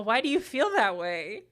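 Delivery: ordinary delivery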